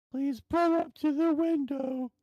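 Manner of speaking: high sing-out drive-thru voice; drive-thru worker. Retold or imitated. Drive-thru worker calling the car forward, high mock voice. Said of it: "all I hear is".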